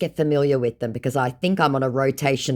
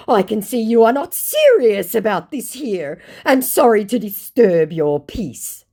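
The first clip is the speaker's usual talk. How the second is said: mock scammer voice; 8 semitones higher, 6 dB louder than usual. Voicing the scammer's exit line.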